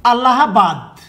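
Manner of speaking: इलाहाबाद said as "Allahabad", opening on an A; English-spelling pronunciation. Shown as a demonstration. Teacher showing students the English spelling, इलाहाबाद pronounced Allahabad. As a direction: sound out इलाहाबाद slowly as Al-la-ha-bad. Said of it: "अंग्रेजी में ऐसे लिखा मिलेगा".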